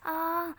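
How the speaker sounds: acted ouch; pained cry "aa". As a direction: yelp as if stabbed. Pen jabbed into her back.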